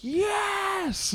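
very high, drawn-out squeal; imitated excited child. Parent imitating daughter thrilled to walk the dog, excited squeal. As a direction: squeal it like a thrilled little kid.

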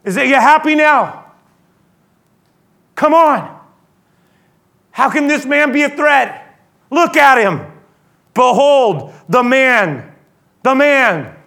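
loud, high, exasperated appeal to the crowd; Pilate pleading. Retold or imitated. Pilate shows the beaten man to the crowd, exasperated and loud. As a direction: plead with them to see reason, loudly. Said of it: "what Pilate is saying is".

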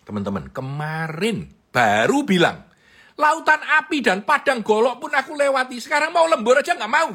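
loud, very high ridicule; mock the broken promise loudly; mocking shout. Speaker ridicules the young man before the class with a loud, high voice. Speaker mocks him to group.

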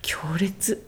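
breathy whispered reaction. Real, not acted. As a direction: hush it, amazed. Reacting to the pink font.

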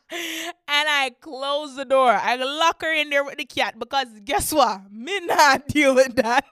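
loud, high, through laughter; cracking up, loudly owning up; laughing confession. Real, not acted. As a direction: barely hold it together while telling it. Laughing confession of abandoning roommate.

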